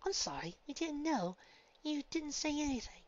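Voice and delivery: apologetic friend. Acted.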